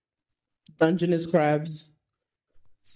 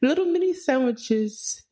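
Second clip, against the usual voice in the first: playful fake British, pitched way up; mock British accent; 4 semitones higher than usual. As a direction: put on a hammed-up posh British tea-time voice. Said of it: "are you gonna talk like that".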